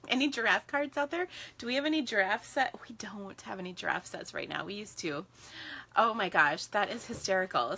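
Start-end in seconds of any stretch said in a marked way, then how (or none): 2.9-5.3 whisper. Real, not acted. Quiet aside about giraffe sets.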